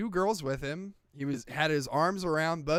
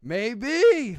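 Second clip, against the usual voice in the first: raised, loud, swooping answer; mock teasing maybe; 6 semitones higher, 6 dB louder than usual. Retelling the actor's parting line, mock teasing. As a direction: let the word swing upward, imitating a teasing star. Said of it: "like that".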